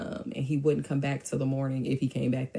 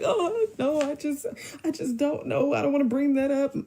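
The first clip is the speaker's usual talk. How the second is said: high, whiny crying voice; mock his tearless weeping; fake crying; 12 semitones higher than usual. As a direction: put on a badly faked sob, pitched way up. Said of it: "he does all this fake crying".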